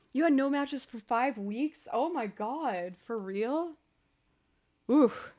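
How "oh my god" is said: ordinary talk, nothing marked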